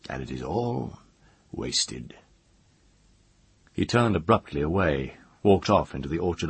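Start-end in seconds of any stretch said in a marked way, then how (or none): 0.1-2.2 low, halting, quiet grief; strained, stifled. A narrator's reading. Mage grieving the woman's life, strained and stifled. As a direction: choke it back, low and soft. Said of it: "His voice was strained and stifled".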